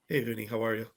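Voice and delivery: low, soft, deadpan greeting; hushed, serious hello with nothing given away; straight-faced producer. Retold or imitated. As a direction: imitate a producer keeping a straight face. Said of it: "like, all straight face".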